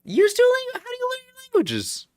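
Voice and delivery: high, disbelieving question; incredulous. Real, not acted. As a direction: act shocked they don't use it. Incredulous, pitch jumps high.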